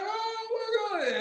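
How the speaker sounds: slow, high, hushed disbelief; drawn-out mock shock; stunned oh my God. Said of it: "I was like".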